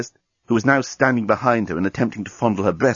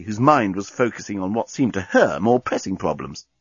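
ordinary delivery

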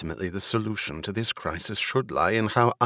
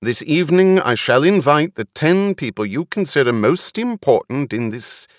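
loud, higher-pitched public address; commanding speech pitched up over a crowd; raised voice; 5 semitones higher, 6 dB louder than usual. A narrator's reading. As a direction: address a crowd with stern authority. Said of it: "She raised her voice".

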